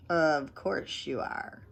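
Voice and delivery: soft slow murmur. Acted. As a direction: murmur it doubtfully. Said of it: "she murmured".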